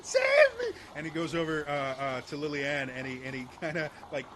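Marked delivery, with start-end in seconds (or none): 0.0-0.6 high, loud wailing plea; terrified leader. Acted. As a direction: shriek and beg for your life, hammed-up. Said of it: "Uh, he's like".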